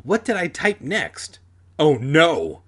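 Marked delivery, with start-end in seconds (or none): 1.8-2.6 loud read-aloud cry of alarm; dismayed, punched-out outburst; shriek. A narrator's reading. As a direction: exclaim it with a mild shriek. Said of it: "I shrieked when I remembered".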